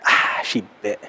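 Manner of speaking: a soft, low tempter's murmur, lightly voiced; devil's voice. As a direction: savour the catch like a gloating devil. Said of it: "And the devil just went".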